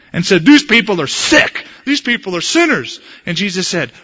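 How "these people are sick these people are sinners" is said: raised, finger-pointing accusation; condemning. Acted. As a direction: condemn them like a self-righteous Pharisee. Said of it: "pointed the finger and condemned people".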